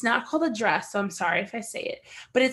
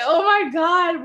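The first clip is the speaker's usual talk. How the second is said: exclaiming; 7 semitones higher, 6 dB louder, slower than usual. Real, not acted. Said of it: "reliving drinking tea".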